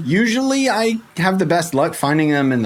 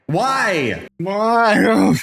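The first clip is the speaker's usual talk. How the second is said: higher, drawn-out dismayed "why"; escalating dismay; 6 semitones higher than usual. Acted. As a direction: build to a hammed-up wail of why. Dismay at empty biome, escalating repeat.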